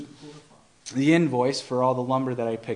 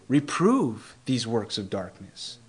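slow solemn verse; 3 semitones lower, 5 dB quieter, slower than usual. Shown as a demonstration. Slow solemn scripture quote.

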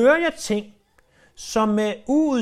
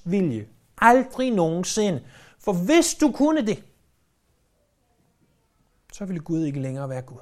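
full-force preaching. Acted. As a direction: drive the point home to the congregation.

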